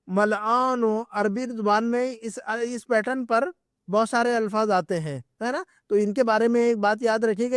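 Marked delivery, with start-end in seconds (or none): none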